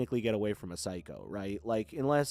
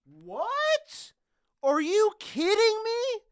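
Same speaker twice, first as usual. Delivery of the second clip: high, loud, drawn-out squeal of disbelief; stunned excitement; 7 dB louder, much slower than usual. Real, not acted. Streamer reacts to a surprise tip from a viewer, stunned squeal. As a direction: shriek it slowly, thrilled and incredulous.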